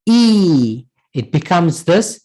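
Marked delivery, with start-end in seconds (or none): 0.1-0.9 E held long as a drawn-out "ee"; elongated vowel. Shown as a demonstration. Teacher showing the ending for "my", E held long. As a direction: stretch E into one long, steady vowel. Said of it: "mad, a long vowel".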